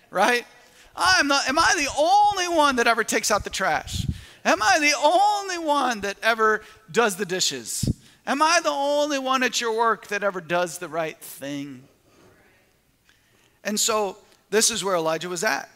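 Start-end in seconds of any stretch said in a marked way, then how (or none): 1.0-11.9 higher, louder put-upon whine; mock a fed-up parent's grumble; complaining parent. Parent griping to the kids in a loud whine. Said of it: "Have you ever said that to your kids?".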